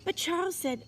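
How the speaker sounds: plain reading voice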